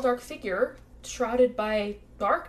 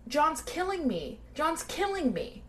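retold scream; 7 semitones higher than usual. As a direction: imitate a scream at talking volume. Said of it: "she hears her sister screaming".

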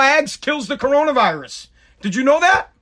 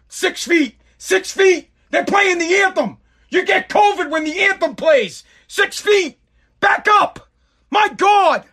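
high-pitched mock alarm, shouting "back up"; mock panic; 10 semitones higher, slower than usual. Ranter mocking anthem-goers who fear covid, hammed-up high panic.

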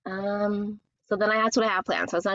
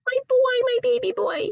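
laugh, then a very high wail of affection; mock-weepy gushing. Real, not acted. Fussing over nephew starting kindergarten, high weepy wail. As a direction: squeal it as if about to bawl. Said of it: "I'm gonna be crying my eyes out".

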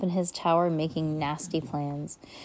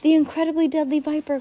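quick, higher answer; prompt answer; 10 semitones higher, 6 dB louder, faster than usual. A narrator's reading. Klaus answering Mister Poe promptly, quick. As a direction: fire the name back at once. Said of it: "Klaus answered promptly".

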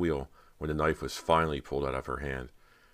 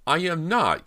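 loud, high shrill denial; shrill protest; 14 semitones higher, 8 dB louder, slower than usual. A narrator's reading. Cody protests being called a quivering jelly, shrill protest. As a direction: protest shrilly, pitch shooting right up. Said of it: "Cody protested shrilly".

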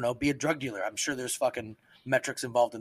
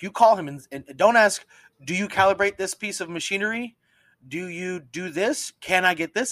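retold instruction; 6 semitones higher, 4 dB louder than usual. Coaching the third, mocking weak ask.

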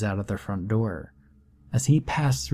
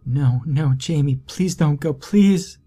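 tearful begging; 5 semitones higher, 4 dB louder than usual. Acted. Morgan begging him to stay.

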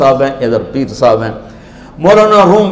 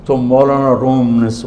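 normal talking voice

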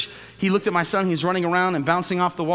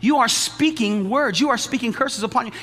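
preaching; 7 semitones higher, 4 dB louder than usual. Acted. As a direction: preach it to the congregation. Preaching at full force.

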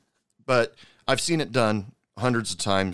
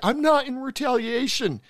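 mocking the owner's denial in a much higher voice; squeaky mock denial; mock defensive owner; 13 semitones higher than usual. Mocking the wife on the news, high and defensive.

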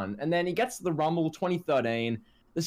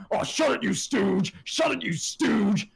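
high, ranting mock commentator outburst; JBL having a fit; 6 semitones higher, slower than usual. Fan mimicking a commentator berating his partner, mock angry fit. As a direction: throw a hammed-up fit, stretching the insult. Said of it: "J B L is having a fit going".

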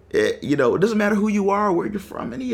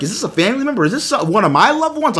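higher-pitched worried questioning; retold panic; 7 semitones higher, 4 dB louder, faster than usual. Dreading bad news about a loved one, retold panic. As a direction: replay your mounting alarm. Retelling panicked thoughts at the knocking.

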